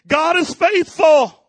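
loud, slow, high declaration of joy; father's glad cry as he runs; joyful proclamation. Retold or imitated. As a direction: call it out slowly to the whole room. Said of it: "I probably would have been saying".

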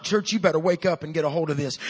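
normal talking voice

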